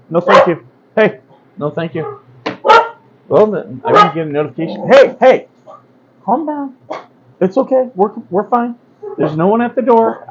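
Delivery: high, loud soothing dog talk pitched well up. Real, not acted. Owner hushing his barking service dog, high and soothing. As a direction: coax the barking dog to calm down and lie down.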